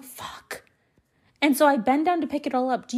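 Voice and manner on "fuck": breathy whispered swear under the breath; whispered curse. Retold or imitated. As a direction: hiss the curse so nobody hears. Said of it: "And I'm like".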